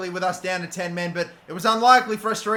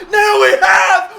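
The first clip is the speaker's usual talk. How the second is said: high, much louder goal yell; ecstatic scream; 10 semitones higher, 10 dB louder than usual. Real, not acted. Gamer celebrating a late goal, screaming. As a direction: explode with joy.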